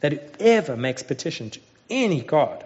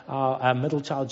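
ordinary talk, nothing marked

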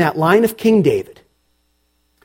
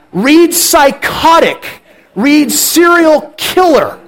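higher, louder, slow and punched; emphatic aside; 8 semitones higher, 5 dB louder, slower than usual. Real, not acted. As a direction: hammer each label home.

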